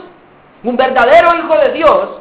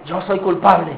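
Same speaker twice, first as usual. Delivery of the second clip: resigned answer; 6 semitones lower, 6 dB quieter than usual. Acted. Accused answers at trial.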